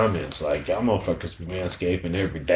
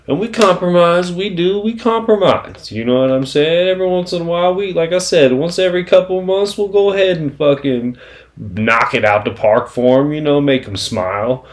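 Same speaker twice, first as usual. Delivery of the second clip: excited high boast; 9 semitones higher, 6 dB louder than usual. Real, not acted. Playful boast to viewers.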